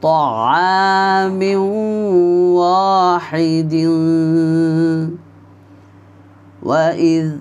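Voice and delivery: وإذ قلتم يا موسى drawn out slowly; slow recitation. Shown as a demonstration. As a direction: recite each word long and clear. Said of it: "আমার সাথে সাথে আপনিও উচ্চারণ করে পড়বেন".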